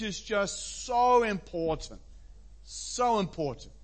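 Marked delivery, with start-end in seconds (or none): none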